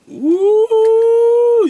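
high, loud falsetto whoop; excited whoop. Real, not acted. Seeing the strawberry middle, a loud high whoop. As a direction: shriek a joyful woo up top.

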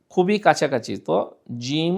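ordinary delivery

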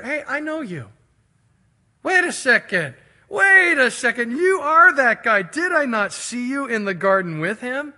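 high, loud, sudden recognition; acted shout of recognition; loud accusation. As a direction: call him out, pitched high and loud. Voices the accuser recognizing Peter.

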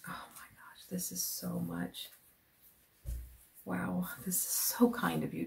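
soft, hushed gratitude; touched whisper. Real, not acted. Thanking Jude for the kind gift, whispered.

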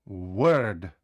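«Word» with the lips not closed on W; unrounded W. Shown as a demonstration. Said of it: "Ми не закриваємо губи". («Word» без закривання губ на W)

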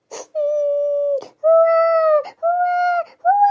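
whimpering hammed-up sob; mock crying. Daughter-in-law wails for flowers, hammed-up crying. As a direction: whine the word like a sulking child. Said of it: "ಅಳ್ಬೇಡಿ ಯವ್ವ".

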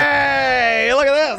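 high, delighted welcome; delighted greeting. Real, not acted. Host welcomes a baby into the studio, delighted.

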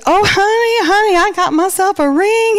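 put-on high, thrilled girlfriend voice; excited friend. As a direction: gush about the ring. Friend announces engagement, voiced.

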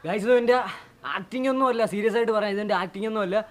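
loud, strained from real chili burn; mouth burning from spicy food. Real, not acted. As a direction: talk through a burning mouth. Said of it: "ഭയങ്കരമായിട്ട് എരിയുന്നുണ്ട്".

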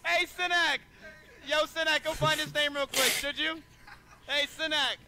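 high called-out hail across the room; calling out. Real, not acted. Streamer calling out to his mod off-mic, high called hail.

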